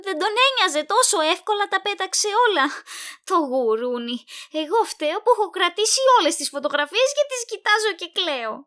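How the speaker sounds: rising to tearful outrage; upset outburst. Acted. Upset about discarded photos. (πληγωμένη αγανάκτηση, πιο ψηλά και δυνατά)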